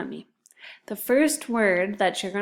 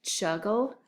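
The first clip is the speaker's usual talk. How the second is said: ordinary talk, nothing marked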